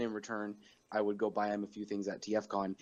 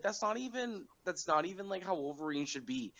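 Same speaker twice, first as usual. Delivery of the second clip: higher-pitched retelling of the actor's objection; lightly voiced actor breaking off; imitated voice actor; 7 semitones higher, 4 dB quieter than usual. Actor stopping himself mid Wolverine voice, lightly imitated. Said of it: "he was like".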